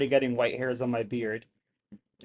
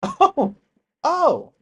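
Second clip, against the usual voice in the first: high, louder surprised exclamations; sudden startled gasps of oh; 12 semitones higher, 6 dB louder than usual. Real, not acted. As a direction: caught off guard. Reacting to strange alien thing.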